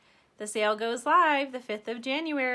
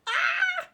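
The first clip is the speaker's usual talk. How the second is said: a high, loud squeal of delight; excited scream; 6 dB louder than usual. Real, not acted. Vlogger shrieks to viewers as she sees the brushes, delighted. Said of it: "I literally legitimately screamed".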